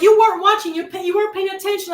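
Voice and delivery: high, loud burst then rapid blame; accusing outburst. Acted. Imagined parent blames the last carer, accusing.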